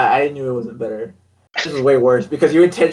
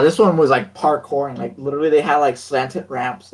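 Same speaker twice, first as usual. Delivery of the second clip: ordinary delivery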